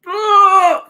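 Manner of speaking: loud, high "oh"; sudden high cry of shock; startled cry. Real, not acted. Streamer reacting to a shock in the match, high loud cry.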